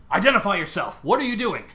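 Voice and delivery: loud, sharp clone trooper challenge. Acted. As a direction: bark it like a soldier at the door. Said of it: "I can do it better".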